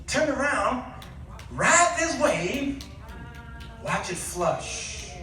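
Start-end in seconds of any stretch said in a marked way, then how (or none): none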